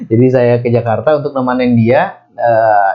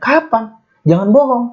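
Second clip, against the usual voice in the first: very high, pleading disbelief; shocked denial; 11 semitones higher than usual. Retold or imitated. He begs the brother not to lie, very high and shocked. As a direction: refuse to believe it. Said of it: "saya kaget kan".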